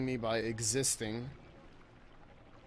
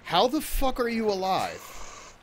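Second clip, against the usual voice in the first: disbelieving yell; 12 semitones higher than usual. Real, not acted. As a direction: yell in real disbelief at the screen. Disbelief at enemy surviving hits.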